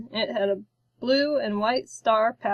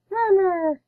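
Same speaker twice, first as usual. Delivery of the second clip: put-on much higher cooing baby-monster sound; creature purr; 9 semitones higher, 4 dB louder than usual. A narrator's reading. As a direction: purr it up high, pleased. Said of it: "it purred".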